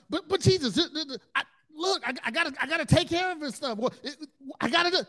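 high, loud, rushed stressed-out pleading; frantic protest. Retold or imitated. Overwhelmed preacher protesting to Jesus, high frantic pleading. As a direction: blurt it out overwhelmed and frazzled. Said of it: "I'm like".

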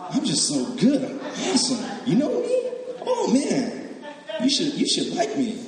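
lightly voiced conceited churchgoer; smug self-praise. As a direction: ham up smug self-love with a laugh. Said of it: "You say like".

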